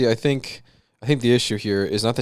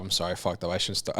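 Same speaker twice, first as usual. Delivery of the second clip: quiet, sheepish apology; barely above a murmur, embarrassed; hushed apology; 6 semitones lower, 12 dB quieter than usual. Real, not acted. To his co-host after a blunder, hushed apology.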